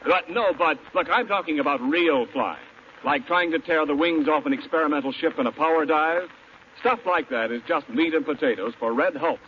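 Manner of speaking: ordinary talk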